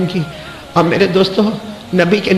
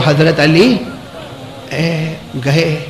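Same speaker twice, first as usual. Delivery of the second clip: ordinary talk, nothing marked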